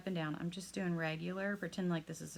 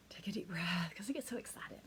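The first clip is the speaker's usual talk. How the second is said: whispered self-reminder; 6 dB quieter than usual. Real, not acted. Calming herself down mid-lesson.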